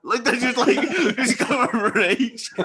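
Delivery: high, loud, breaking into laughter; real laughter, words half lost; laughing. Real, not acted. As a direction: laugh through the words at the memory. Laughing at the story.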